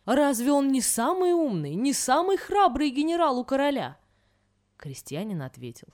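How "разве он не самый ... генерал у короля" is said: indignant general, raised pitch and volume; angry. A narrator's reading. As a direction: demand an answer, affronted and louder. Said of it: "Генерал рассердился". (сердито, возмущённо)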